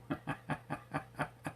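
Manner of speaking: soft, rapid string of chuckles; light chuckle. Real, not acted. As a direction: chuckle quietly to yourself. Chuckles after getting back on air.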